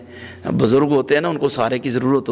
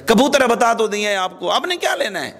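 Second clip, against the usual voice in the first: pitched up high in exasperation, fading at the end; temper flaring; 7 semitones higher than usual. Retold or imitated. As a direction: flare up at your father. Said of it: "تو اب وہ اس کا پارا high ہو گیا".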